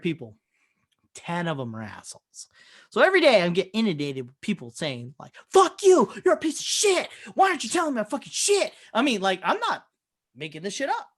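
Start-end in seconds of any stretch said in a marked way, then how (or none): 5.5-8.7 high-pitched mock tirade; angry caller. Angry patients berating a clinic worker by phone, irate. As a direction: rant like an irate caller, fast by the end.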